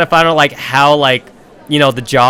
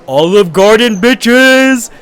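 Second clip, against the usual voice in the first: loud, higher whooping cheer; hyped cheer; 6 semitones higher, 5 dB louder, much slower than usual. Retold or imitated. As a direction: yell it like a victory chant. Friends cheering about fancy dinner.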